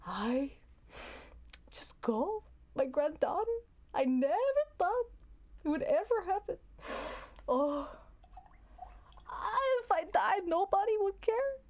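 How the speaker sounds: high, teary fake sob of a grandmother; a grandmother milking fake tears; mock crying grandma. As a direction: wail it out to make them feel bad. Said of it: "They're not really crying".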